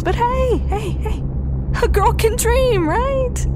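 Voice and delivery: sing-song high "hey, hey". Acted. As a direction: lift way up, teasing herself.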